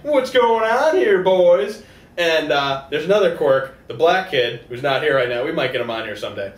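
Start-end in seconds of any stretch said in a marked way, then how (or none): 0.0-1.8 slow, higher prying question; nosy busybody. Pretend. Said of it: "She's always butting in like".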